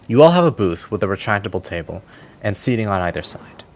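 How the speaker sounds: ordinary talk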